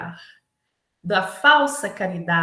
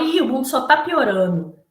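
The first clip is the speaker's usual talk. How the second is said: mock pessimist whine; 3 semitones higher, 6 dB louder than usual. Quoting a pessimist complaining.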